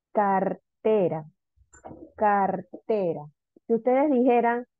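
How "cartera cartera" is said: "cartera" drawn out slowly, leaning on TE; slow teacher's demo of "cartera" with force on the TE; stressed syllable demo. Shown as a demonstration. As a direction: say "cartera" slowly in parts, car-TE-ra. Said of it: "en donde ustedes ejercen más fuerza de voz".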